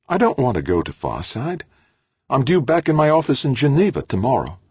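lightly voiced woman pushing back; Halleck's voice. Halleck protests going to Farside.

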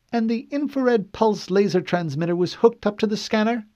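high, precise questioning woman; cool female interrogator. Acted. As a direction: question him coolly.

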